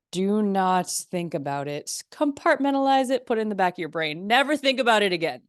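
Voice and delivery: put-on rigid inner drill; mock stern self-command. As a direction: order yourself to shut feelings away. Voicing the suppress-your-feelings approach.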